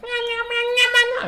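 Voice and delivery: very high squeaky meows; baby meowing. Retold or imitated. Stranded baby answering him, in tiny high kitten meows. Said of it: "And he'd be like".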